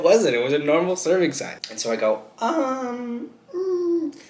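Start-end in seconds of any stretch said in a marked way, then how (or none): 2.4-4.2 very high-pitched hesitant hum; high, drawn-out stalling noise; awkward stalling. Retold or imitated. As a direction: squeak out a long, uneasy um. Stalling before refusing a customer.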